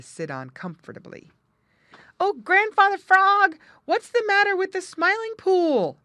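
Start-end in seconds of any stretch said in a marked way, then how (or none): 2.2-6.0 very high, loud urgent call; shouting. A narrator's reading. Two friends race up to ask Grandfather Frog, shouting. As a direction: holler for an answer from an elder. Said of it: "they shouted as they came up quite out of breath".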